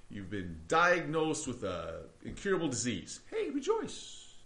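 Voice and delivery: sing-song fake cheer over bad news; mock cheery. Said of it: "is Paul saying".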